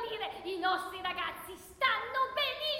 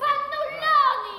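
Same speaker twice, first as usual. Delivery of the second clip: insult shout; 3 semitones higher, 10 dB louder, much slower than usual. Acted. Heckling insult.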